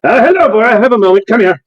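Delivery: an odd master cooing at his dragon; eccentric breeder. Acted. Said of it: "He's like".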